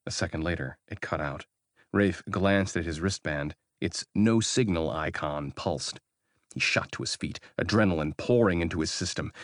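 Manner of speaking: usual speaking voice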